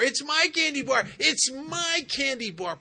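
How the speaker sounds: put-on loud, high tantrum of a little girl; stubborn little girl. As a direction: wail it like a small girl clutching her prize. Stubborn girl refuses to let go.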